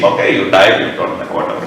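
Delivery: low, careless shrug of a queen; dismissive queen. Acted. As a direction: brush off the king's death, trailing off to nothing. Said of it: "she cares least".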